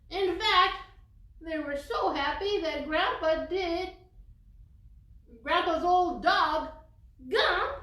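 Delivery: put-on lively storybook read for little kids; story reading. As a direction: read it aloud like to a class of children. Reading picture book to children.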